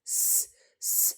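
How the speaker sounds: "स, स" as a bare unvoiced hiss; just hiss "स" with no vowel; hissed s sound. Shown as a demonstration. Teacher showing students the s sound in books. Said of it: "स, एस sound आई".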